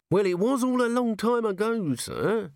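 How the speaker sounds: high, anxious placating voice; Nobby anxious. A narrator's reading. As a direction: soothe the angry boss.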